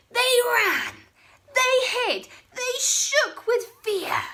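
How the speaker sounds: high, loud breathless telling; dramatic narration. A narrator's reading. Narrator telling the dinosaurs fleeing, high and excited. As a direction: pump up the panic as they flee.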